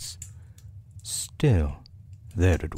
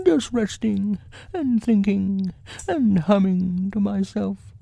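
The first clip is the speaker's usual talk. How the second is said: high, airily casual bear voice; careless; 13 semitones higher than usual. A narrator's reading. Pooh denying he is stuck, high careless voice. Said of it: "said Boo carelessly".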